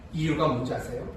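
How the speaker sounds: ordinary talk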